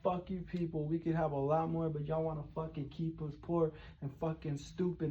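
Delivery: under-the-breath grumble at the crowd; muttered rant. Retold or imitated. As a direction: mutter it darkly to yourself. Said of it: "I was like".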